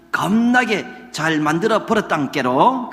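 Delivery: playful mock Jeolla dialect. Shown as a demonstration. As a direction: lean on the Jeolla ending. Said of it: "전라도 말로 이 말을 표현한다 그러면".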